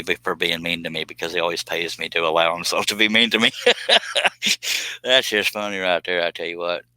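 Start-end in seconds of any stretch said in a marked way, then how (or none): 3.5-5.0 laugh. Real, not acted. Laughing at Jamie joke.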